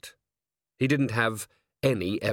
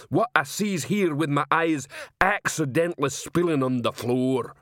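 high, slow mock-innocent troll commentary; troll feigning clumsiness; 6 semitones higher, slower than usual. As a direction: overact a clumsy accident as a troll.

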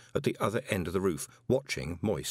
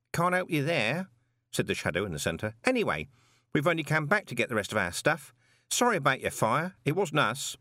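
higher, offhand rooftop rogue; casual shadowy figure; 6 semitones higher than usual. Acted. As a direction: shrug it off, breezy. Shadow in centre speaks.

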